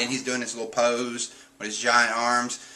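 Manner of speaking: ordinary delivery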